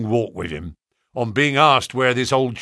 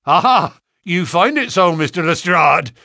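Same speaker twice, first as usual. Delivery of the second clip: loud, higher gloating cry; triumphant; 6 semitones higher, 7 dB louder than usual. Acted. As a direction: crow over a beaten rival. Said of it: "cried Gregson triumphantly".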